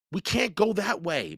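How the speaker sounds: put-on objecting girl. A narrator's reading. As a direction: warn him off.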